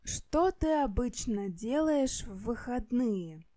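normal talking voice

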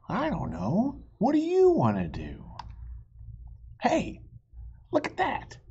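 high, animated storybook voices; high read-aloud with a little hushed squabble; reading to children. Acted. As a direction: play both bickering friends for kids. Reading picture book, two voices.

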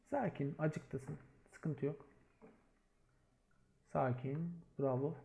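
soft, low reassurance; hushed calming. Real, not acted. Captain quietly calming an exposed teammate in a clutch. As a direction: talk him down quietly.

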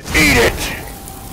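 very high breathy scream; screamed attack. Real, not acted. Gamer screaming at the giant while attacking. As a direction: scream it as you hit.

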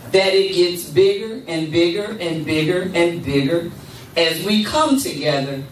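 ordinary delivery

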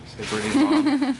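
high, laughing self-correction. Real, not acted. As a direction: giggle through the words.

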